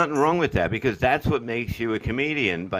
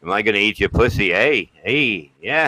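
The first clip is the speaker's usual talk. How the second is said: louder, fast Canadian mock with eh; Canadian accent; 6 dB louder than usual. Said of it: "and it's like, fucking".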